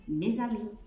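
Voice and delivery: "mes amis" linked as mez-ami; liaison demo. Shown as a demonstration. Teacher showing the liaison in "mes amis". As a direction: run the s into amis as a z. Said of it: "خلي بالنا في الليزون".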